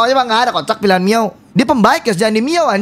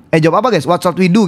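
ordinary talk

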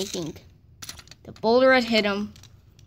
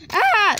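loud high yelp of surprise; a sudden high scream; high startled scream; 9 semitones higher, 6 dB louder than usual. A narrator's reading. Henry yelps as the boulder rolls at him, startled.

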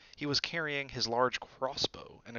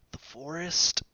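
Malfoy uneasy; 6 semitones higher, 6 dB louder, slower than usual. A narrator's reading. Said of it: "didn't sound quite as cool as usual".

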